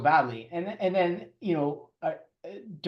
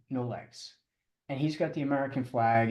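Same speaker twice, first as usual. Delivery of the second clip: normal talking voice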